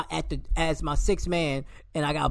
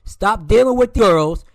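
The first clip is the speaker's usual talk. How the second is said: mad scolding; 5 semitones higher, 7 dB louder than usual. Real, not acted. Fan scolding his player like a coach. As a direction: lay down the law, word by word. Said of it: "He's mad, mad".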